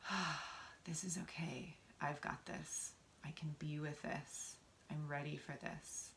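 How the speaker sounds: soft, whispery sigh of relief; soothed self-talk. Acted. Voicing how feeling safe sounds, softly reassured.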